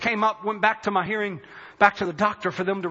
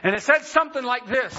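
ordinary talk, nothing marked

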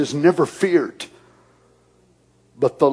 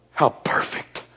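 whispered awe; 5 dB quieter than usual. Acted.

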